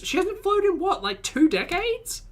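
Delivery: high, slow, dramatic exclamation; mock outrage. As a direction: gasp it out in exaggerated disbelief. Teasing friend about not flying.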